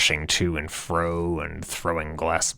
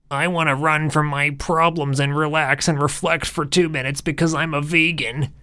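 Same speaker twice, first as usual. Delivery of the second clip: much higher mocking whine; mock whiny thought; 9 semitones higher than usual. Narrator mocking Earl's sigh, high whiny parody. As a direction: sneer it as a parody of him.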